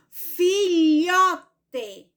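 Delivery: "Filhote" drawn out, leaning hard on LHO; slow, separated "Filhote", strongest on LHO; stressed middle syllable. Shown as a demonstration. Teacher helping pupils hear the strong syllable, "Filhote" stressed on LHO. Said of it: "Vamos pronunciar novamente e vamos ouvir nossa voz".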